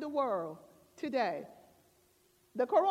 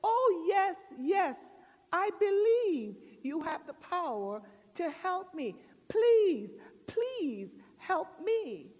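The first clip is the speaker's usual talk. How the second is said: high, loud, hammed-up begging; mock desperate yes and plea; man pleading; 5 semitones higher, 4 dB louder than usual. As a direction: agree eagerly, then draw out the pleases. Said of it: "And the man said".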